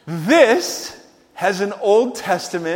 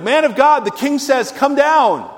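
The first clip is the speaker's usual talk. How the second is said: lightly acted order, higher and louder; commanding captain; 7 semitones higher, 5 dB louder than usual. Captain to Elijah on the hilltop, lightly voiced command. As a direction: call out a king's command.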